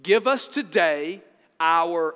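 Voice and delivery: slow and loud, leaning on "today"; stress on today. Shown as a demonstration. Preacher repeating Jesus' prayer, loud slow stress on "today". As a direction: hold "today" out firmly. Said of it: "Underline the word today".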